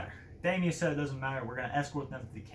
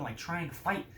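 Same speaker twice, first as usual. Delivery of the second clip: usual speaking voice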